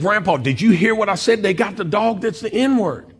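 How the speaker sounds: high, insistent retold complaint; worked-up, high-pitched disbelief; incredulous protest. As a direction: press him, appalled he has no reaction. Retold protest to silent grandfather.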